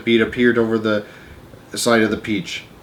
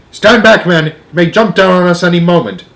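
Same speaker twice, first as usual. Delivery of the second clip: loud, high urgent order; yelled warning; 6 semitones higher, 6 dB louder than usual. A narrator's reading. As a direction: shout the men away from danger. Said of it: "yelled the chief of police".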